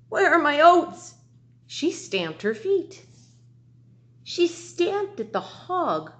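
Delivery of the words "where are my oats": loud, high demanding goat; a goat shouting for its oats. Acted. Teacher reading to her class, as a loud high goat. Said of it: "a goat begin to bleat".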